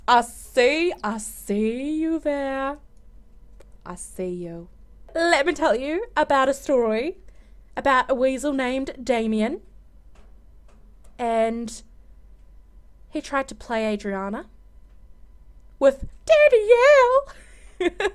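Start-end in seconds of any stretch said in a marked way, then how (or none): 0.1-4.7 drawn-out playful taunt; slow teasing sing-song. Pretend. Teasing a Sim she spots, slow and playful. 15.8-18.2 much higher, breaking into laughter; can't get it out without laughing. Real, not acted. Gossiping about the weasel, laughing through it.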